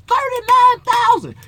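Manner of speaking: "Thirty-nine thousand" cried out high, slow and loud; mock disbelief. Real, not acted. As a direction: exclaim the number in outrage. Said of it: "I got thirty-nine thousand views".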